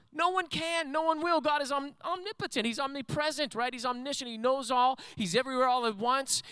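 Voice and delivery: very high, strained preaching pitch, easing at the end; high-pitched sermon fervor; impassioned preaching. Real, not acted. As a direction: challenge the congregation in a high cry. Preaches with raised voice.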